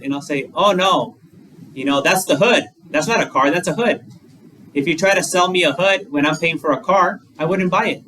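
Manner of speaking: ordinary delivery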